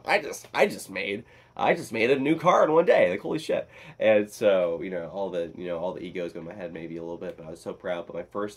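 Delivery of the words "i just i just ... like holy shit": higher, louder thrilled outburst; retold excitement. Telling viewers about his best day, retold excitement. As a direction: blurt it out, acting out the thrill.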